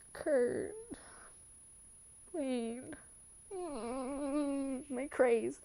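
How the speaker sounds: real tears, barely holding it together; weepy, choked names; crying. Real, not acted. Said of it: "I'm gonna stop crying now".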